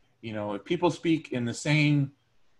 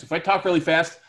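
fast and excited; 5 semitones higher, 6 dB louder than usual. Shown as a demonstration. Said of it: "I sound excited".

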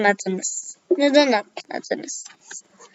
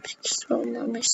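breathy whisper, no voice at all; 9 dB quieter than usual. Pretend. Gamer whispering to viewers about the missing subscriber. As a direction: breathe the line out like a secret. Repeats line, then whispers it.

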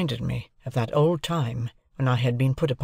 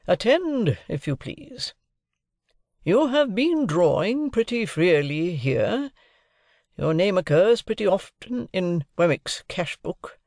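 high, deliberate cross-examining voice; domineering lawyer; 6 semitones higher, slower than usual. A narrator's reading. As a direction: lay out the case point by point. Lawyer figure questioning his ward.

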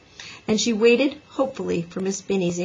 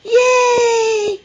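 high, loud drawn-out cheer; yelling cheer; 8 dB louder than usual. A narrator's reading. Big boys cheer from school window, yelling. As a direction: whoop from an open window. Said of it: "yelled some big boys".

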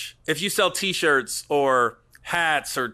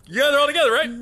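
mock usher; 8 semitones higher, 4 dB louder, much faster than usual. Mock usher checking seated fans.

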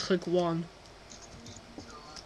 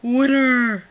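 loud triumphant yell; victory shout; 5 semitones higher, 9 dB louder than usual. Real, not acted. Victory shout after winning.

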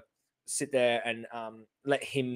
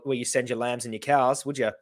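ordinary talk, nothing marked

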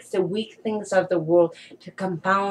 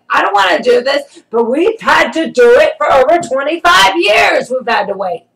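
loud, raised, emphatic testimony; impassioned preaching; 5 semitones higher, 6 dB louder than usual. Real, not acted. Telling listeners the flesh hates waiting, impassioned preaching. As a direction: preach it hard, punching the key words.

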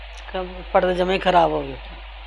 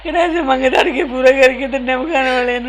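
crying; 5 semitones higher, 4 dB louder than usual. Real, not acted. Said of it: "mummy ਨਾ ਰੋ ਦੇਖੀ।".

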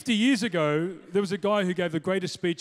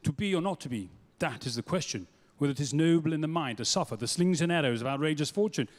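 low, measured English thespian recital; lofty English actor's accent, pitched low; English Shakespeare; 4 semitones lower than usual. Retold or imitated. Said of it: "they do Shakespeare like this".

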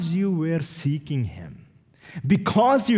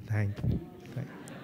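soft, lower, bashful thank you; sheepish thanks; 9 semitones lower, 13 dB quieter than usual. Retold or imitated. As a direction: accept the compliment shyly, almost swallowed. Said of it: "I said".

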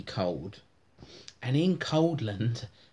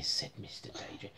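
whisper; 5 semitones lower, 11 dB quieter, faster than usual. A narrator's reading. Narration tag whispered.

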